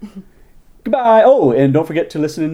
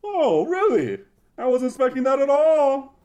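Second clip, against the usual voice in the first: pompous king voice; 12 semitones higher than usual. Acted. King voiced in radio play.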